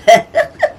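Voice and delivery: high, loud little giggle; a bright girlish giggle; Pam's giggle. A narrator's reading. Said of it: "she said with a giggle".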